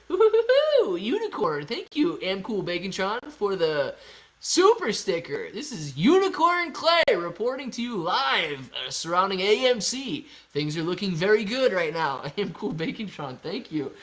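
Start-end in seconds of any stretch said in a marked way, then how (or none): 0.1-10.3 high-pitched whinny into playful announcing; unicorn impression. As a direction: play a silly unicorn reporting live, pitched up. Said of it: "That was my, uh, unicorn impression".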